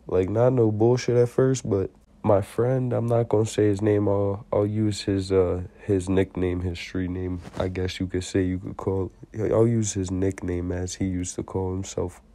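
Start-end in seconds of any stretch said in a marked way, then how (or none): none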